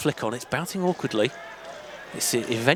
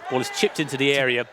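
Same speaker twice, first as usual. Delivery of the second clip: usual speaking voice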